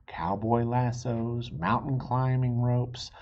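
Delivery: ordinary delivery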